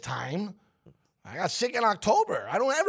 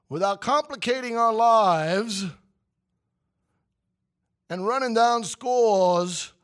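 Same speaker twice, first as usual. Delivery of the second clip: slow, louder sports-show opener; announcer voice; 5 semitones higher, 6 dB louder, slower than usual. Acted. Opening the baseball segment for listeners: slow, louder announcer read. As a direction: roll it out like a broadcast intro.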